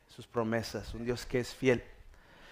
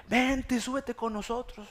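high-pitched child imitation; little girl coaxing; 9 semitones higher, slower than usual. Girl begging grandma to come along, acted high coaxing. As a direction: plead in a put-on little girl voice. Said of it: "Llega otra de sus niñas".

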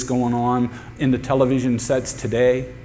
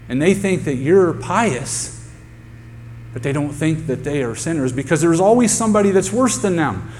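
raised, driving sermon voice; fiery preaching; 5 semitones higher than usual. Real, not acted. Preacher rebuking the congregation, fiery raised preaching. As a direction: press the point on the congregation.

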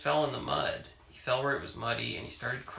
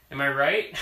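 laughing repeat, pushed louder; repeating it through a laugh; 3 semitones higher, 11 dB louder than usual. Shown as a demonstration. Parent teasing child, laughing louder.

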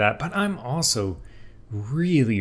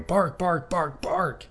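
high, wild barks fading off; frantic dog barking; 10 semitones higher, slower than usual. Acted. Odie going cuckoo bananas, barking nonstop. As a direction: bark like a dog gone cuckoo. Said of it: "He's screaming. He's angry. He's going".

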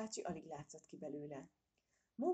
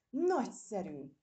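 slow, loud, higher cry of joy; delighted squeal; 4 semitones higher, 7 dB louder, slower than usual. A narrator's reading. Mother squirrel squealing with joy at the prophecy. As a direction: shriek it with delight. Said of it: "sikoltotta Mókus anyó".

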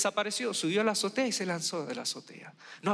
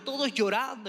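normal talking voice

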